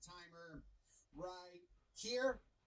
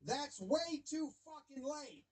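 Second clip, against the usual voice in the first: higher, slow mock protest; voicing an angry manager; 5 semitones higher, slower than usual. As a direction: act out the manager yelling at the umpire. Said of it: "He says".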